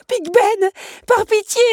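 high, loud cry of despair; desperate plea. Acted. Belle begs Big Ben for pity, desperate shout. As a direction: beg at full voice, desperately. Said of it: "se désespéra Belle".

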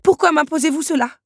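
high, loud anguished protest; distressed demand. A narrator's reading. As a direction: demand an answer, crying out. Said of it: "demanda-t-elle". (protestation angoissée, voix aiguë)

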